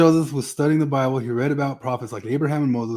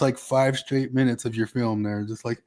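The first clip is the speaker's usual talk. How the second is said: usual speaking voice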